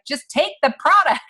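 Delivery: high, urgent plea to just take it; pleading push. Retold or imitated. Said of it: "wrangle these people and be like".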